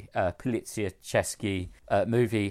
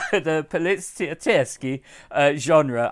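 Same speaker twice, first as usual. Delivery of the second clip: hammed-up Italian Polizioteschi; Italian accent; 6 semitones higher, 7 dB louder than usual. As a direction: roll out Polizioteschi Italian style. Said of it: "You sound like the Duolingo owl".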